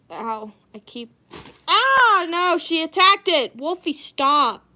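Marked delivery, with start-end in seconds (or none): 1.7-3.5 much higher and louder yelp of alarm; startled cry. Real, not acted. Cat attacks the bag. 3.6-4.6 firm warning. Real, not acted. Telling the cat off.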